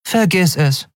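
put-on a child's high, blunt brush-off; cruel dismissal. A narrator's reading. Child Orin bluntly shuts down Kai's escape plan. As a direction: crush his hope in two words. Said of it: "klang es doppelt grausam".

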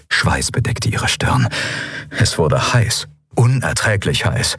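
usual speaking voice

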